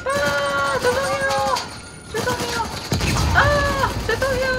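very high pitched panicked sorry; panicked apologies. Real, not acted.

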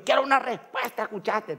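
high mock telling-off; reto imitado de mamá. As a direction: demand an answer, imitating an irritated wife. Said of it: "Ella grita". (imitando a una esposa que exige respuesta, voz aguda)

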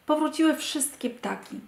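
plain reading voice

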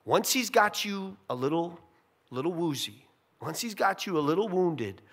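ordinary talk, nothing marked

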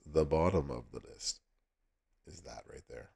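ordinary talk, nothing marked

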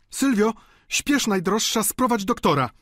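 high, loud urgent woman's voice; zaalarmowana wdowa. A narrator's reading. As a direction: send the maid off for help. Wdowa wzywa pomocy dla chorego. (wysokie, naglące wołanie gospodyni)

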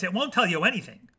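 plain reading voice

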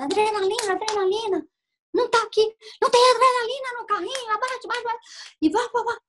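high, rushed emergency calls; doctor shouting during resuscitation; urgent doctor. Retold or imitated. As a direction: bark out orders in a code. Voicing doctors rushing to resuscitate.

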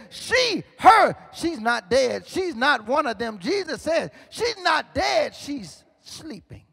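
preacher's rising cry, fading to a hush; preach. Acted. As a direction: proclaim it, then drop to a hush. Preaching Jesus' declaration at full force.

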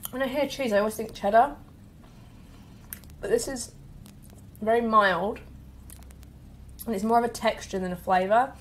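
eating between words; chewing while talking. Real, not acted. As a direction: mouth partly full of bread. Seen 0: bites at -3; jaw working, fingers to mouth between remarks.

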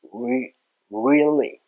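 slow, stretched "really" with the tongue pushed forward; drawn-out forward L. Shown as a demonstration. Teacher urges learners to push the tongue, slow forward-L "really". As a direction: draw out "really", reaching forward for the L. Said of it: "そんな感じで".

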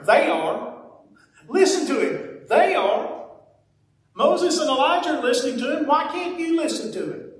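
a put-upon parent rolling his eyes; sarcastic God. Retold or imitated. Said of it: "in my sarcastic mind, I can almost see God going".

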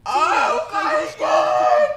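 slow, drawn-out loud yell; slow stunned holler; shocked shout. Real, not acted. Shocked reaction to scene.

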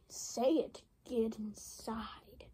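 slow hushed whisper; whispering to Luigi to get in. Acted. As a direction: hiss it under your breath. Urging Luigi in secretly.

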